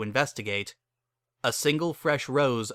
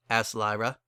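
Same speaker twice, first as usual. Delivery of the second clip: usual speaking voice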